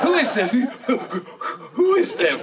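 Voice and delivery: high sharp repeated demand; suspicious high voice. Retold or imitated. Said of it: "Tag's number".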